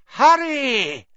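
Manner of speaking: icy, high-pitched call of the name; high cold voice. A narrator's reading. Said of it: "the high, cold voice".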